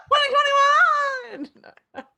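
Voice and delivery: high, loud, very drawn-out shout; yelled. Acted. Co-host yells the year to her partner, high shout. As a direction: yell the year out, stretching it right out. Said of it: "I had to yell that".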